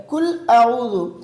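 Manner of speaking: "کل اعوذ" with kaf for qaf, hamza for ayn; common mispronunciation. Shown as a demonstration. Teacher imitates friends' common mistake, mispronounced qaf and ayn. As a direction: say "کل اعوذ" quickly with a light front k and no throat sound. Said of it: "قاف کو کاف پڑھتے ہیں".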